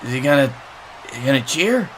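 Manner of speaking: ordinary talk, nothing marked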